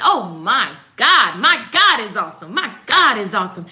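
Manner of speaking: loud, emphatic praise, punching GOD; celebrating. Shown as a demonstration. Celebrating that God is awesome, exultant praise. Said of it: "Like, you're celebrating".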